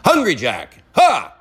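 hammed-up commercial pitch; mock ad read. Host fakes a potato ad to listeners, hammed-up pitch. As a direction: pitch it like an over-keen sponsor spot. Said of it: "That's not a real ad".

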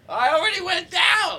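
high wailing yell; whiny high shout. Pretend. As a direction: wail it high and desperate. Crying-shout comic exchange.

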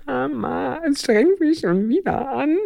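put-on high, whining little girl's voice; child complaining to mum, whining; whiny child. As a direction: whine it like a worn-out little kid. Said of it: "Sagen, es ist schwer".

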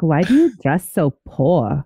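slow, lightly put-on German-tinged Anna Delvey accent; a hint of Anna's odd European accent, drawn out; mock Anna Delvey's accent, lightly. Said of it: "I can't do an accent like Trav can".

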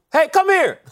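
high, punchy street holler; shouted show title, pitched well up; yelled summons. Pretend. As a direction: call out sharply to a passerby. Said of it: "and I yell at people".

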